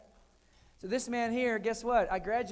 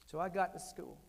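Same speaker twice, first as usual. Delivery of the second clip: normal talking voice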